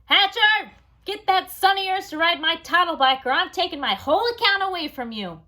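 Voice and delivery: Mister Vincent losing his patience, loud and high; impatient boss. Acted. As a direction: blow up at the ad man. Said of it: "Mister Vincent was losing his patience".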